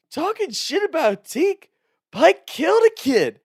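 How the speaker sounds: very high incredulous yell; mock drunken outburst. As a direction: throw it back at them loudly. Repeats drunk friend's roast.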